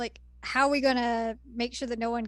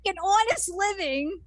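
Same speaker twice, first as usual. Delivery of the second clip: mock indignant; 8 semitones higher than usual. S1 joins Miroku voice.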